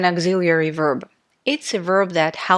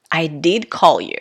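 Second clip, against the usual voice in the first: "did" stressed firmly; 2 semitones lower than usual. Shown as a demonstration. Teacher repeating an example for students, stress on "did". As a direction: lean on "did" for emphasis. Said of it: "how I pronounce these sentences".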